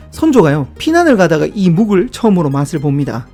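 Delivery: ordinary talk, nothing marked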